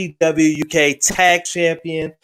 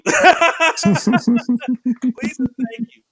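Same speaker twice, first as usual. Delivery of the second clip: laughing; 7 semitones higher, much slower than usual. Real, not acted. Laughing excited about the match.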